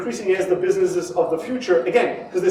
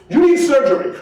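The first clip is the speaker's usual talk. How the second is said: loud, higher snap diagnosis; mock blunt doctor; 6 semitones higher, 6 dB louder than usual. Doctor to patient just through the door, bluntly. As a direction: blurt it out as an overeager doctor. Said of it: "and the doctor goes".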